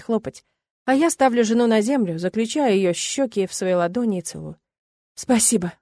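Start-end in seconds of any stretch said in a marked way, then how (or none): none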